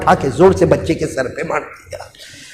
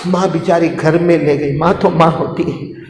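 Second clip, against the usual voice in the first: ordinary delivery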